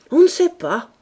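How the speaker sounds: loud, high, sour snap; bad-tempered. A narrator's reading. Wife snapping at husband, sour and loud. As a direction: snap back in a foul mood. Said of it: "de méchante humeur".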